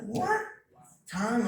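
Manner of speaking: put-on a baffled boy's yelp; confused kid. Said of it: "I'm like".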